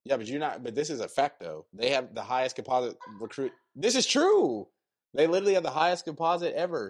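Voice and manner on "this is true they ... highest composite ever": high, loud insistence; insistent. Real, not acted. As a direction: spike it up, this is a fact. Heated sports argument insists fact.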